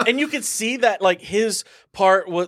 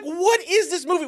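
baffled outburst; 10 semitones higher than usual. Retold or imitated. Retold baffled reaction.

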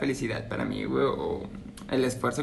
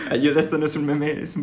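ordinary delivery

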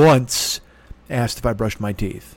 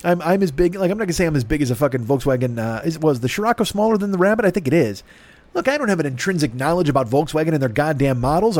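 ordinary delivery

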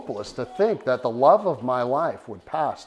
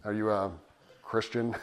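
condescending tone; 5 semitones lower, 9 dB quieter than usual. Retold or imitated. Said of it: "the most condescending tone ever".

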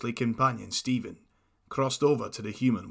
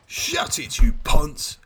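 angry villain shout; 3 semitones higher, 8 dB louder, slower than usual. Acted. Villain snapping at heroes.

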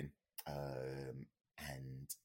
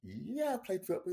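bewildered yes; 4 dB louder than usual. Retold or imitated. Answering the odd question.

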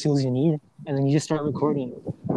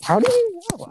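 surprised; 3 dB louder than usual. Real, not acted. Startled at a correct guess.

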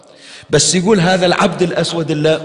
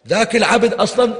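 normal talking voice

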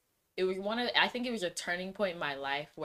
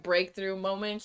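usual speaking voice

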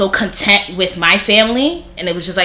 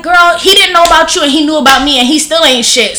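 high, louder and emphatic; blunt retort; 8 semitones higher, 5 dB louder than usual. Retold or imitated. To her sister about their absent dad, loud and emphatic. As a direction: lay it out, fired up. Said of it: "but I'm like".

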